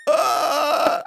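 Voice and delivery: high, loud winded wail; breathless groan. Retold or imitated. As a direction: imitate straining for breath on the ground. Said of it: "they can't breathe for shit".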